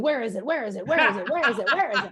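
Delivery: fretting, staring at the door; mock anxious pacing question; anxious repeating. Anxiously waiting for money to arrive.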